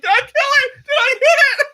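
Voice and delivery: very high squealed mock panic; imitated panicked screaming. Imitating Mom Brain after nearly hitting bird, screaming. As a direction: shriek it in hysterical falsetto. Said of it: "laughing, screaming, and crying all at the same time".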